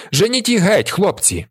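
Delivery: high, urgent order; commanding cry. A narrator's reading. Commander urging his boys to chase them off, high commanding cry.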